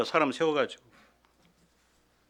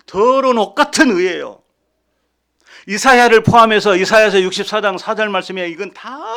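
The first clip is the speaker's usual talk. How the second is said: loud, high, driving sermon voice; fervent preaching; 7 semitones higher, 13 dB louder than usual. Acted. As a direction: preach it hard to the congregation. Preaching at full force.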